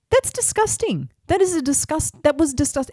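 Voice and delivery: high-pitched, disgusted outburst; retold indignation. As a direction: voice shoots up in outrage at what was said. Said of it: "that angered me".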